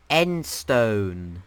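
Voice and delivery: loud, stretched-out title; announcer call. Real, not acted. Host naming the next dimension for viewers, loud announcement. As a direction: announce it loudly, drawing it out.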